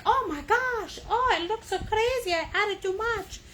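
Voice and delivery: high, dramatic fretting; mock panicked painter. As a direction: play the flustered beginner. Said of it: "say you're like".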